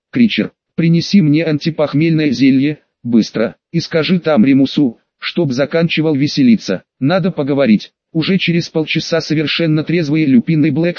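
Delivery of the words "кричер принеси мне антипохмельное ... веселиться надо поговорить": plain reading voice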